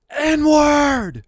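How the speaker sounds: high, loud mock yell of a stranger in the street; imitated screaming. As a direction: belt it out like a man yelling at night. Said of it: "screaming the N-word on the top of his lungs".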